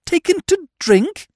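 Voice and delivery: very high, scandalised question; shocked. A narrator's reading. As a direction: echo it in high-pitched horror. Eve shocked by the claim.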